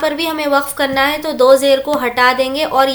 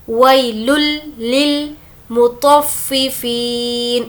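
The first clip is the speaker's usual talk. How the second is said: slow, drawn-out tajweed recitation; measured Quran recitation; much slower than usual. Shown as a demonstration. Teacher slowly reciting the verse for students. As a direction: intone each syllable carefully. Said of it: "तो को पुर पढ़ेंगे और फा से मिलाएंगे".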